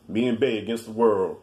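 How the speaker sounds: low, mocking read of a couple's caption. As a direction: sneer it like a cheesy post.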